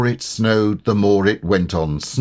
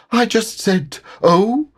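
nervous, very high little voice; small and jittery, pitched right up; Piglet; 3 dB louder than usual. A narrator's reading. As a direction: squeak it out anxiously. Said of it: "said Piglet nervously".

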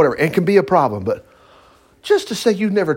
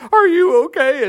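high-pitched, hammed-up distress; imitated crying; 13 semitones higher, 4 dB louder, slower than usual. Woman to her new best friend after the fall, mock-tearful. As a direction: check on a friend in a mock wail. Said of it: "She's crying".

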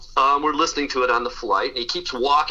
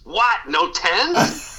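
mock scream; 6 semitones higher, much slower than usual. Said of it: "And he screams".

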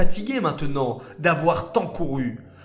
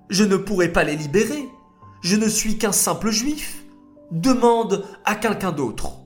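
higher, humble pleading; pleading refusal; 6 semitones higher, 3 dB louder than usual. Acted. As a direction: beg to be let off, modestly. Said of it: "Moshe refusa". (Moshe refuse humblement, en suppliant)

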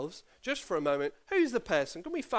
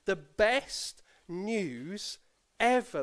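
put-on repeating the slogan for the children; emphatic catchphrase; much slower than usual. Shown as a demonstration. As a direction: stretch "the best news" then lift "ever". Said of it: "share this with us this morning".

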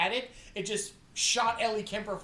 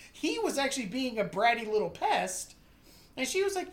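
ordinary delivery

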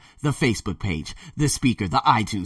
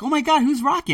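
surprised. Pretend. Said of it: "a lot of people are gonna be like".

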